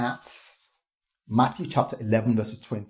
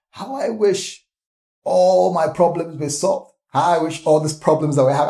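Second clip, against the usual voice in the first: raised, louder longing exclamations; wishful lament; 5 semitones higher, 6 dB louder than usual. Acted. Onlooker envying a happy passer-by, loud wishful lament. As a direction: cry out the wish, higher and louder. Acting out an envious onlooker's wishing.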